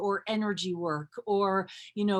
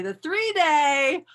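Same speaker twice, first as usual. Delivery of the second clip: teasing excitement; 2 semitones higher, 6 dB louder, slower than usual. Real, not acted. Teasing the bigger class.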